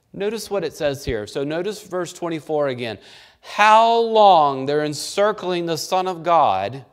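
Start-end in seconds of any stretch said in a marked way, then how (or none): 3.4-4.7 loud, high, hostile "How long"; demanding shout. Retold or imitated. Preacher voicing the hostile crowd at Jesus, loud demand. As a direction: bark it at him like an angry crowd.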